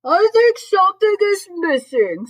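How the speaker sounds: high, loud, drawn-out Santa; Santa's voice. A narrator's reading. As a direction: read Santa big and slow. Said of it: "said Santa".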